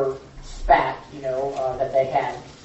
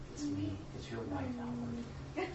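humble and soft; 8 semitones higher, 13 dB quieter, slower than usual. Acted. Wife humbly answering her husband at the door, soft and meek. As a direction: say it meekly, almost hushed. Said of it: "a very polite knock".

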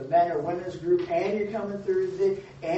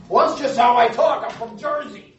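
mock Jersey guy; 4 semitones higher, 9 dB louder than usual. Mocking an excuse for rough talk.

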